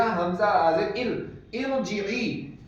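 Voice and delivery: इरजिई drawn out very slowly with an i on the alif; very slow teacher's demo of इरजिई; Arabic pronunciation. Shown as a demonstration. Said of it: "अलिफ के नीचे जेर लगा दिया".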